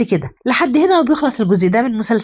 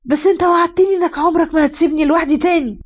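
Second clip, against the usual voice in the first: mild raised-voice plea, pitched high without real volume; 7 semitones higher than usual. A narrator's reading. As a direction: call out a broken promise in a higher voice. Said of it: "وإليف بتعلي صوتها". (صوت عالي الطبقة فيه عتاب)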